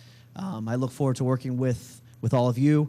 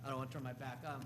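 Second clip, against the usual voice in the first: far from the microphone, faint; speaking away from the mic; 21 dB quieter, faster than usual. Real, not acted. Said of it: "No, [name], use this".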